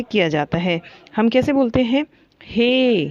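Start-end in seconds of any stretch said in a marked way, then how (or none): 2.4-3.0 हे stretched long like calling someone; drawn-out call. Shown as a demonstration. Teacher shows students a long call: हे drawn out. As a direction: call हे, holding the vowel. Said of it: "जो खींच के जो बोलते हैं".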